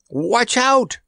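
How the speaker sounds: high-pitched cry of warning; urgent warning. A narrator's reading. Randy warns the elephant he rides, urgent warning. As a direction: call out a sudden warning. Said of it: "warned Randy".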